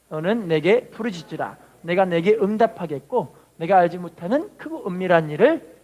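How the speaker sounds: measured, solemn Bible reading. Acted. Reads scripture aloud.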